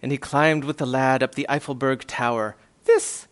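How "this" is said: very high squeaky declaration; crying tiny mayor. A narrator's reading. Mayor rallies the lad on the tower, high impassioned cry. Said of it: "cried the mayor".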